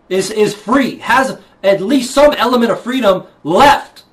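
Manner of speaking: rising, loud righteous anger; indignant outburst. Real, not acted. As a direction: build it into a furious protest. Indignant rant about money.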